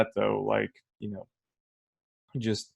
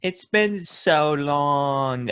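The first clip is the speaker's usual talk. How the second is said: higher, very drawn-out mock aunt gush; quirky aunt; 5 semitones higher, much slower than usual. Co-host joining in as quirky aunt, hammed-up drawn-out greeting. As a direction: stretch it out like a doting aunt. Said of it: "quirky aunt who's like".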